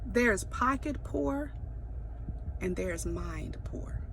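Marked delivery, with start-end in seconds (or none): 2.6-4.0 hushed whisper, pointed. Real, not acted. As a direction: lean in and whisper it. Whispered punchline.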